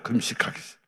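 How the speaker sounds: breathy whisper, half to himself; whispered aside. Retold or imitated. Preacher whispering to the congregation that he hates fasting. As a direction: whisper it like a guilty secret.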